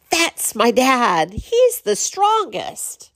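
put-on high, slow proud little boy. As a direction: squeak it out like a proud kindergartner. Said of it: "he would say".

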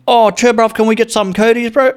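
high-pitched, loud put-on street accent; exaggerated "bruv" accent, high-voiced. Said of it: "I don't wanna use the accent".

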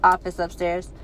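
usual speaking voice

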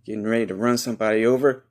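normal talking voice

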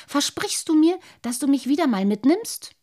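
put-on high, loud eager child; begging to come again. A narrator's reading. Little boy asks the carp for another ride, high and eager.

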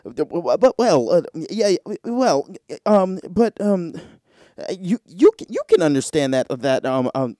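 mock a news anchor dodging the question; stammering newscaster. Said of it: "newscasters do this thing".

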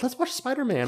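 put-on high, soft excited child; imitating an eager kid asking. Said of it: "my kids are like".

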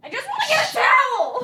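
shouted high and annoyed; exasperated shout. Real, not acted. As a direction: yell it in frustration. Shouting while cleaning a spill.

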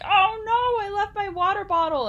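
high, loud wail over a lost bottle; panicked friend. Retold or imitated. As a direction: cry out in dramatic dismay. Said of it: "he was like".

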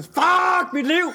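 drawn-out high scream; retelling his worst day as a long high scream; screaming at the sky. Retold or imitated. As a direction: scream it slowly at the heavens. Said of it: "skriger jeg bare mod himlen".

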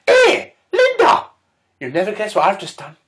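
squeaky high gossip voice, stretched hey; high mock Barbara. Comedian voicing Barbara to Linda, high mock lady voice. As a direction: pitch up high as a chatty old lady.

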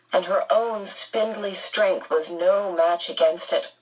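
ordinary delivery

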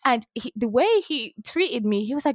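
normal talking voice

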